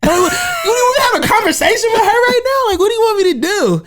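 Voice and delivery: high, laughing incredulity; high laughing what-do-you-want; laughing disbelief. Retold or imitated. Incredulous retold reaction, laughing.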